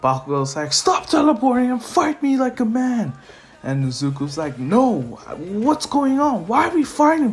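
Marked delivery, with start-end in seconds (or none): 0.7-3.1 high, loud furious demand; angry challenge. Acted. Bakugo demanding Izuku fight him, angry. 4.6-7.3 high, loud confused pleading; alarmed yell. Acted. Izuku asking Bakugo why they fight, loud.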